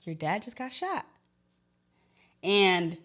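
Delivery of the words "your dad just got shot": soft, higher, hushed bad news; grave quiet news. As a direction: deliver it softly, stunned. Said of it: "she said".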